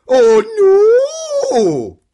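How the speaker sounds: loud, high, drawn-out Scottish wail; dismayed Scottish cry for kids to copy; Scottish accent. Shown as a demonstration. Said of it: "in your best Scottish accent".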